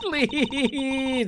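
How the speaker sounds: a first high take on please. Shown as a demonstration. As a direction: try the word please up high. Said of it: "There we go".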